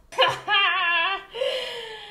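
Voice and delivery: long, high-pitched laughing fit; helpless laughter. Real, not acted. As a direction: crack up and let the laugh run on. Cracks up in the outtake.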